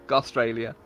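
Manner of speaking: ordinary delivery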